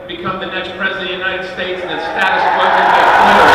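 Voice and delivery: loud, rousing rally speech, swelling to a shout and then easing; rally oratory. Acted. A candidate firing up a rally crowd, loud and rousing. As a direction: pump up the crowd line by line.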